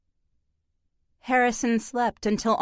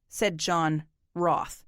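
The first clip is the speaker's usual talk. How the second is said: ordinary delivery, nothing marked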